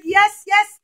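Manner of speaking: loud, high cheer; thrilled crowd calling out; excited. Acted. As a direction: cheer like you found treasure. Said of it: "they were so excited".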